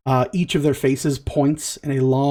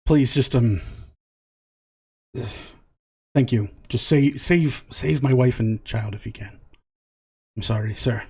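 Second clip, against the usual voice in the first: lower, halting, sighing plea; defeated pleading; 4 semitones lower, 3 dB quieter than usual. Acted. Intimidated man begs for wife and child, subdued pleading. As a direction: give in meekly, sighing between phrases.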